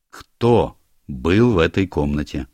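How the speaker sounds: ordinary delivery, nothing marked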